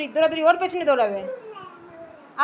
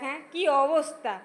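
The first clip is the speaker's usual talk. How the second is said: normal talking voice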